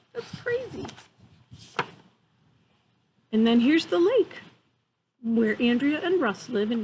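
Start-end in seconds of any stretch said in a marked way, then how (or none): 0.1-0.9 amazed. Real, not acted. Reacting to otter photo.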